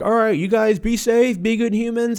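high, cheery sign-off voice; mock sign-off. Vlogger imagining his sign-off, high cheery voice. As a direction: chirp a cheery goodbye to viewers. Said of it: "I could be like".

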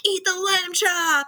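high, drawn-out imitated order; comic high-pitched demand; mock angry command. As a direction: insist on it like an exasperated friend, played for laughs. Acted quarrel over breakfast.

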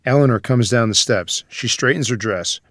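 usual speaking voice

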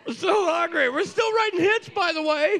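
high, crying bird voice; high whiny bird voice. Pretend. Trapped ravens begging, in a high crying bird voice. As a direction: whimper it like a starving raven.

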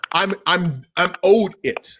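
usual speaking voice

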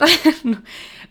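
sudden high laugh; high burst of laughter. Real, not acted. Friend's retort amuses her.

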